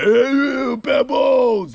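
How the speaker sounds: loud, high boxer holler, drawn out; Rocky. Acted. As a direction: holler for your sweetheart like the movie boxer. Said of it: "That's how he talks".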